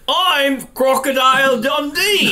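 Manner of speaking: high, slow hammed-up Australian; drawn-out mock Australian, badly done; bad Australian accent. Host plays Crocodile Dundee, badly faked Australian. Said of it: "do my Australian voice".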